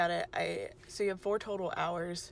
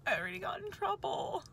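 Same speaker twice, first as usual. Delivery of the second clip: mock sheepish; 12 semitones higher than usual. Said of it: "And I'm like".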